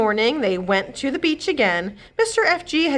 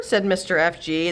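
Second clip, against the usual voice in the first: ordinary delivery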